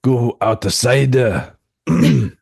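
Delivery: mock Japanese accent; halting put-on Japanese accent. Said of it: "should have had your Japanese accent out".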